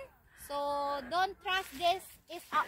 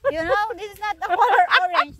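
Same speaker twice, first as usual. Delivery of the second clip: insistent loud; 10 dB louder than usual. Real, not acted. Insisting loudly on the color.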